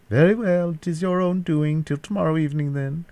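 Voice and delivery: high-pitched villain taking leave; captor's parting ultimatum. A narrator's reading.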